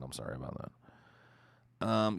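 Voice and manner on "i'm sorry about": normal talking voice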